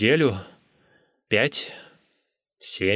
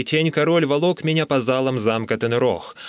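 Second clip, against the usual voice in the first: usual speaking voice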